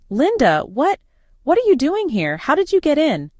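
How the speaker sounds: high, stammering shocked voice; shocked panic. A narrator's reading. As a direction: sputter in alarm. Patricia caught, shocked.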